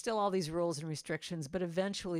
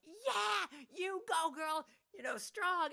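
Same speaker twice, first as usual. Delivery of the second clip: much higher, bright cheer of encouragement; 11 semitones higher, slower than usual. Retold or imitated. Cheering the baggage handler through the plane window, high and excited. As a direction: cheer her on like a fan from the stands. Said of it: "miming gesture of".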